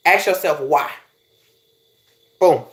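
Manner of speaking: ordinary talk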